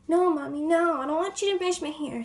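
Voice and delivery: put-on a kid refusing to let her mother brush her hair; bratty kid fuss. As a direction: throw a little tantrum over the hairbrush. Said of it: "screaming and yelling".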